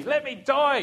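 high-pitched imitation of a scream; mild mock scream; retold scream. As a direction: act out his desperate cry without full volume. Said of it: "he's screaming".

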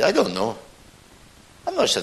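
lightly voiced Scotsman, pitch jumping up; puzzled Scottish pub-goer; Scottish accent. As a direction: answer as an unsure Scotsman. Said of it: "And the Scotsman said".

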